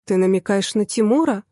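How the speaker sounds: plain reading voice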